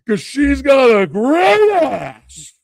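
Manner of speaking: slow, loud, high shout; hammed-up Pacino original; original Pacino line. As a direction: deliver the real bellow. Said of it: "the memorable line from Al Pacino".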